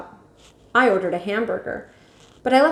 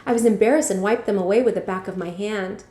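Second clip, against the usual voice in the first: ordinary delivery, nothing marked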